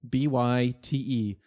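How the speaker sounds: letter by letter, slow; spelled out slowly. Shown as a demonstration. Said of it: "like megabyte".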